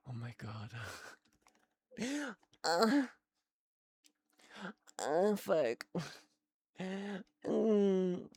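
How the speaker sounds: strained, cracking exclamations; croaky, breaking sounds. Real, not acted. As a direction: voice giving out as she reacts. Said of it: "I'm losing my voice".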